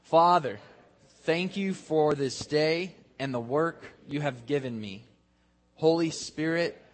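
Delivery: measured, projected prayer in short phrases; enthusiastic group prayer. Real, not acted. Preacher leading the congregation in a closing prayer, with enthusiasm. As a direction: lead a crowd in prayer, phrase by phrase. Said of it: "say this with enthusiasm".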